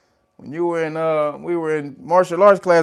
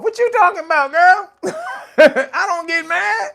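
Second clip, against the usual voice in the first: squeaky high mock denial, much higher and louder; mock indignant falsetto; 7 dB louder than usual. Streamer mock-denies to chat that he gets mad, in a squeaky high voice. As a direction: play the outraged innocent in a silly high voice.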